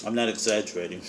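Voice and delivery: talking through a mouthful; words round a bite of food; mouth full. Real, not acted. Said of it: "I am talking with my mouth full".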